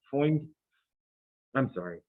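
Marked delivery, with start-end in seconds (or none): 0.1-0.5 "Formed" as "foimed", supposedly Boston; mock Boston accent. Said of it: "that's more Boston, isn't it".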